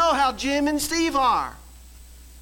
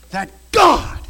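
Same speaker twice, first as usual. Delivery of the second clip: preaching shout; 3 semitones higher, 10 dB louder than usual. Real, not acted. Preacher to church, shouting God. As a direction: shout God, much louder.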